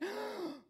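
a sudden high gasp as breath is taken away; sharp gasp. Retold or imitated. A sharp gasp, breath taken away by the Spirit. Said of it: "and I went".

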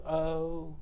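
soft, drawn mock-meek "oh"; a hushed little mock sigh; mock meekness. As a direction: imitate a timid man, softly. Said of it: "And he wasn't just".

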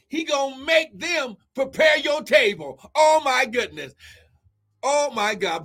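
normal talking voice

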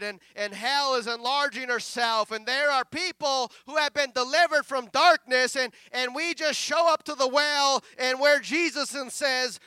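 loud, driving sermon delivery; preaching. Real, not acted. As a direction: preach it forcefully to the congregation. Preaches at full force.

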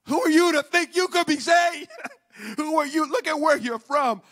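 loud, driving sermon delivery; full-force preaching. Real, not acted. Preacher urging the congregation not to be discouraged, full-force preaching. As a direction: preach it hard, phrase after phrase.

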